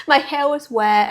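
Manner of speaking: a real laugh at her own wet hair. Real, not acted.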